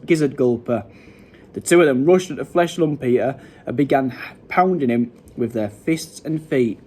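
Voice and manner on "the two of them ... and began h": usual speaking voice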